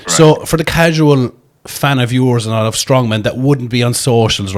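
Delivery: ordinary talk, nothing marked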